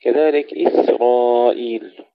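إسرائيل with a calm light S and thick R; no bounce on the S of إسرائيل; still sin, heavy ra. Shown as a demonstration. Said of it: "تسكين السين جيدا بدون قلقلة". (تسكين السين وتفخيم الراء)

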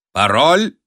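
loud call rising at the end; sentry's challenge. A narrator's reading. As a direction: hail an unseen stranger sharply. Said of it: "окликнул темноту часовой".

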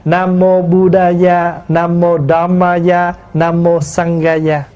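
measured Buddha Gia, Dhamma Gia, Sangha Gia as "buda-ya, damma-ya, sanga-ya"; Sanskrit originals. Shown as a demonstration. Monk recites the three refuges' originals, measured Sanskrit pronunciation. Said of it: "phiên âm từ tiếng Phạn".

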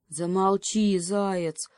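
ordinary delivery, nothing marked

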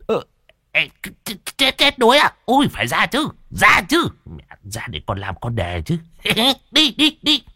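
very high stammering squeal; stammer, then pipe up excitedly; startled, eager crony. Acted. Đồng startled by drowning news. (giọng the thé lắp bắp hớn hở)

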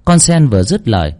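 plain reading voice

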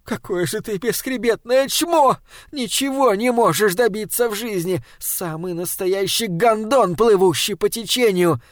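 weeping wife hurling insults, furious; sobbing curses. A narrator's reading. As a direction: curse him while crying in rage. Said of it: "Сыпались проклятия с её губ".

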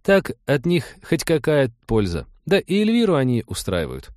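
earnest, apologetic female character; earnest Trixie. Acted. Voices Trixie explaining.

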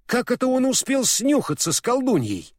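high, incredulous question; surprised. A narrator's reading. Фарамант asks how the traitor joined the witch, astonished. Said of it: "удивился Фарамант".

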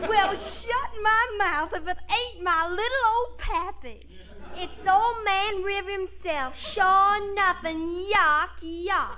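slow, hammed-up Southern belle drawl; heavy Southern accent. A daughter teases her Confederate daddy in a thick Southern accent. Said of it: "that Southern accent as heavy as we can".

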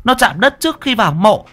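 put-on old granny voice, alarmed and commanding; urgent old woman. A narrator's reading. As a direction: bark orders at the lads. Old woman orders pallbearers urgently.

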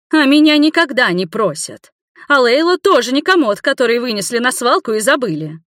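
high, loud tirade; heated, high-pitched telling-off; indignant retort. A narrator's reading. Retort in a family quarrel.

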